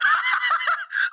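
high gleeful laugh; short squealing burst of laughter. Real, not acted. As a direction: let out a high-pitched laugh. Laughing at scared player.

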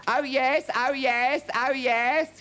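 high, loud threefold town-crier call; herald's proclamation. A narrator's reading. Herald opening his king's proclamation, calling out. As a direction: cry it out to the whole arena. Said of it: "began to read".

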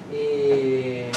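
ordinary talk

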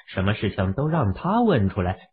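plain reading voice

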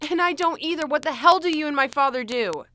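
loud, fed-up outburst; exasperated demand. Acted. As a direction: demand an answer loudly. Demands the truth.

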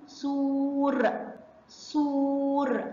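"سور" with oo held very long; drawn-out phonics "سور"; long vowel. Shown as a demonstration. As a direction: stretch "سور" slowly before the final r. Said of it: "سامعين الصوت الطويل إزاي".

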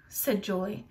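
ordinary delivery

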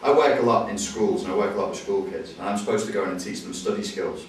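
ordinary delivery

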